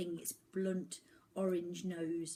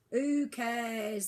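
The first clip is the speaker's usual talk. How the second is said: dismissive; 4 semitones higher, 7 dB louder than usual. A narrator's reading. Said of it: "said Mister Culpepper, shrugging".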